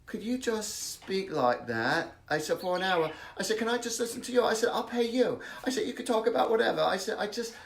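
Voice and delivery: eager imitation British accent; put-on British accent. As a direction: plead politely in a mock British accent. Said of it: "not as choppy as this".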